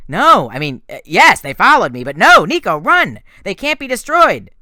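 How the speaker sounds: loud, rising shouts to flee; urgent yelling. A narrator's reading. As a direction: yell at the kid to run from the skeletons. Percy yelling during skeleton attack.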